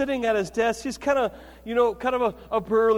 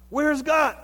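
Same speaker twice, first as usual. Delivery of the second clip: higher, pressing question; booming pastor; 5 semitones higher than usual. Retold or imitated. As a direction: lean in and demand it. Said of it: "this big booming voice".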